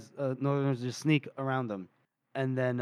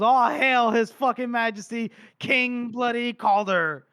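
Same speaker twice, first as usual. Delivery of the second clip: high, loud and slow hammed-up royal salute; mock proclamation; 7 semitones higher, 4 dB louder, slower than usual. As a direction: proclaim it like a herald, half joking. Pale Snow proclaims Calder king.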